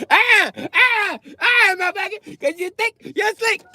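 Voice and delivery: very high, loud shrieking; high squealing scolding; shrieking woman. Retold or imitated. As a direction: screech at him like she caught him. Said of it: "She was like".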